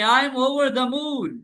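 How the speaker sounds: slow, high, beaming line; over the moon. Shown as a demonstration. Teacher shows an enthusiastic answer to students. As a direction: lift the word over. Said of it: "ending vibes पता नहीं क्या है".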